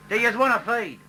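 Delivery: loud, high chat-up line; cheeky ocker bloke. Retold or imitated. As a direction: call out like a flash larrikin showing off. Winner chatting up girl.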